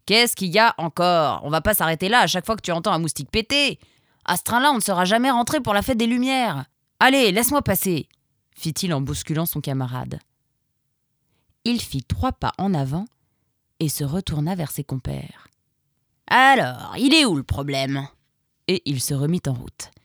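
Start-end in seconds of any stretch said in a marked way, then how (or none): 0.0-8.1 fast, louder, rising into an exasperated shout; impatient grumbling. Acted. The pelé scolding the nervous rablé, fast impatient exasperation. 16.3-18.1 taunting challenge. Acted. The pelé turning back to mock his comrades, loud taunting.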